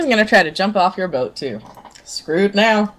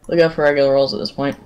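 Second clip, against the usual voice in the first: mouth full. Real, not acted. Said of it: "excuse me eating with my mouth full".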